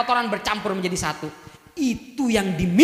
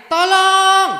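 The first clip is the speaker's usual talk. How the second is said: higher, louder call of "Tolong"; louder cry for help; 9 semitones higher, 8 dB louder than usual. Shown as a demonstration. Preacher demonstrating a stronger scream to the women, loud and high. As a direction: raise the cry a step. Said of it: "Ada yang".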